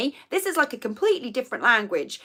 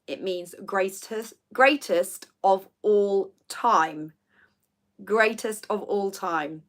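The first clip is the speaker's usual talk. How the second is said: greatest of all time spelled out slowly; slow emphasis; 2 semitones lower, slower than usual. Real, not acted. Explaining the acronym slowly to viewers. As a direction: let each word land slowly.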